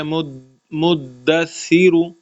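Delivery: slow مدثر with a soft th-like ث; soft saa demo. Shown as a demonstration. Teacher showing the student a soft ث in مدثر. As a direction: ease the ث in مدثر, no force. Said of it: "سا کو بھی نرمی سے پڑھنا ہے".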